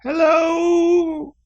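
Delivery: put-on high bright old woman greeting; cheerful old lady hello. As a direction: chirp a sweet hello. Grandma greets party brightly.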